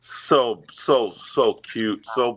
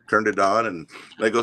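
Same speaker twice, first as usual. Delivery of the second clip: ordinary delivery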